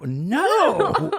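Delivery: high mocking no; mocking the cohost's scared no; mock refusal. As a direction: imitate a nervous friend refusing. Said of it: "and you go".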